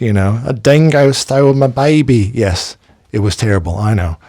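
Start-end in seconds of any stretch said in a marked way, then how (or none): 0.4-2.3 overdone fake Australian accent, a bit higher and louder; Meryl Streep's bad Aussie accent. Mocking Meryl Streep's bad Australian accent in the film. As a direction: ham up a terrible Australian accent. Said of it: "Meryl Streep doing her worst Aussie accent".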